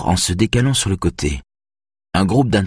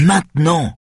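firm command; 9 semitones higher than usual. A narrator's reading. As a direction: cut him off firmly. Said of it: "dit-elle fermement".

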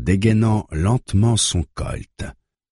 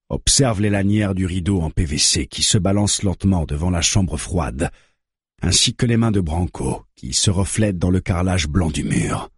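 plain reading voice

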